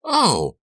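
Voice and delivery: sharp high gasp of fright; startled shriek. A narrator's reading. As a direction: yelp in sudden shock. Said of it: "I let out a startled shriek".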